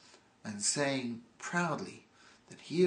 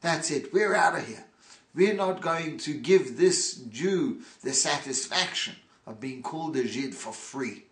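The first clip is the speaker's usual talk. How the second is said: indignant outrage; 2 semitones higher, 6 dB louder than usual. Retold or imitated. Hooligans refuse to be called Zhid for free, indignant. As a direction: storm off in offended huff. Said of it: "turn around one to the other and say".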